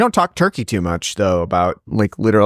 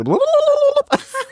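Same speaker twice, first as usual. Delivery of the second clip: gobble like a wild turkey; turkey gobble call. Shown as a demonstration. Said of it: "This is what they sound like".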